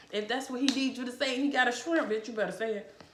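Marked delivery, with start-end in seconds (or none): none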